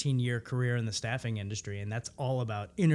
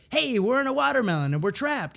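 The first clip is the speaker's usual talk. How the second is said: high, louder alarm call; alarmed partner; 11 semitones higher, 5 dB louder than usual. Shown as a demonstration. Scene partner declaring they are trapped, high alarm. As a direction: announce the trap urgently. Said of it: "if my scene partner said".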